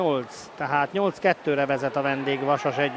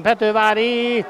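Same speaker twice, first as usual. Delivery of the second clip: excited hungarian sports commentary; 6 semitones higher, 8 dB louder than usual. Real, not acted. Final penalty goal called.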